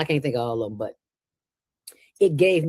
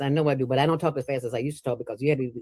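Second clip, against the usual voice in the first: put-on rattled off very fast like an old radio host; fast radio talk demo; 3 semitones lower, much faster than usual. Shown as a demonstration. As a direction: race through it to show how fast you talked. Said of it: "And I still talk fast".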